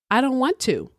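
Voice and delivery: high, bratty refusal; petulant high whine. A narrator's reading. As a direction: refuse like a brat trying to annoy. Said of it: "She was just being a brat".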